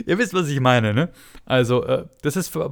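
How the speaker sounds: ordinary delivery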